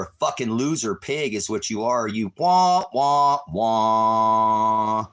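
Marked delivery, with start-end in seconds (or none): 2.1-5.1 mock crying. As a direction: jeer at them like a whining crybaby. Mocking deputies as crybabies.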